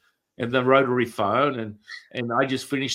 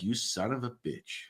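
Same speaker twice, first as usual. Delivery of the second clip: a hint of a resentful teacher, quiet; muttered curse; 10 dB quieter than usual. Teacher swearing at the student under his breath.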